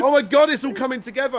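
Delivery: very high, loud excited realisation; thrilled outburst as ideas click; freaking out. Retold or imitated. Guest relives his ending idea clicking, freaking out excitedly. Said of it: "I just started freaking out".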